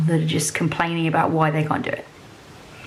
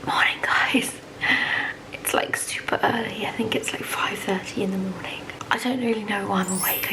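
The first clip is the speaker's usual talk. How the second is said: soft early-morning whisper so nobody wakes; 7 dB quieter than usual. Real, not acted. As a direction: whisper to keep from waking him. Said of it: "sorry, I'm whispering because Tom is asleep".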